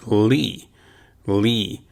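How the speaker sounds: li said like English lee; Spanish li. Shown as a demonstration. Teacher showing students li, said like lee. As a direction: li as a short clean lee. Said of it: "as if it were L E E".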